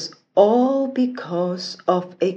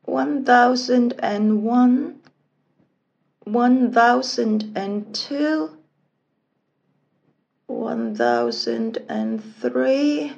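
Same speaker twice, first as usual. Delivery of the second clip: slow, higher-pitched sing-song counting; counting sheep; 5 semitones higher than usual. A narrator's reading. Sleepless princess counting sheep in bed, slow drawn-out counting. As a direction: count slowly, drawing out each number.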